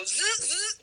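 little buzzing drill noise; short mimicked drill whirr; imitated dental drill. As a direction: buzz like a tiny dentist's drill. Imitates dental drill beside teeth.

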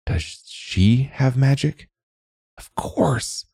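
high, slow astonishment; dawning wonder. A narrator's reading. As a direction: let the realization lift the voice. Realizing she has magic.

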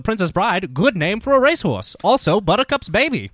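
high, loud race call; race announcer voice. Acted. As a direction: call it like the horses are running. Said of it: "race announcer voice".

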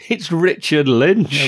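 drawn-out, high, loud name reveal; excited reveal. Real, not acted. Co-host revealing who pulls up in the car, excited. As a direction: announce it big, slow and high. Gleeful reveal of surprise villain.

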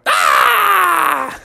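high, sudden family shriek of fright; family jumping at the scare with a high yelp; startled scream. Acted. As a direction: shriek as if spooked. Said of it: "And they all went".

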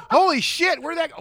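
high, loud startled yelp; shocked onlookers. Retold or imitated. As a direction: shout it like stunned peasants. Voicing people finding the body.

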